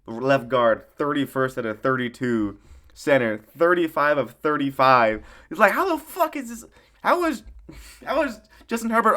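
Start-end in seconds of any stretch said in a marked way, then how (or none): 5.5-9.2 incredulous. Real, not acted. Exasperated at the bad O-line.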